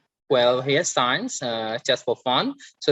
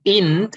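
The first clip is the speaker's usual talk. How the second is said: "in" higher and louder, as a stressed syllable; 8 semitones higher, 5 dB louder than usual. Shown as a demonstration. Teacher isolating the syllable to stress, "in" said high and loud. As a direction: punch "in" on its own, raised in pitch. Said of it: "you stress on in".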